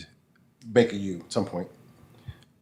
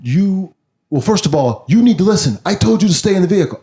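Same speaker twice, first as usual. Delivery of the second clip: irritated cop; 8 semitones higher, 4 dB louder than usual. Retold or imitated. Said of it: "he's like".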